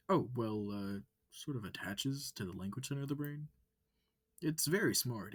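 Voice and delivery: high, loud, eager wolf voice; bright curious alien asking questions. Acted. Amicus questioning the human, high and eager.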